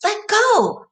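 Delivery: high loud cry. A narrator's reading. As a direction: shout it to break free. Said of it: "she cried".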